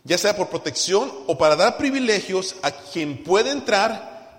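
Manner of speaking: loud, high preaching at full force. Acted. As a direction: thunder it from the pulpit. Predica con fuerza. (predicación a todo pulmón, fuerte y alta)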